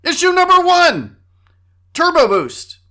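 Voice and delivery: big, high-pitched announcer shout; shouted announcement. Pretend. Host to listeners announcing the first issue, shouted announcement. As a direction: shout it out like a segment title.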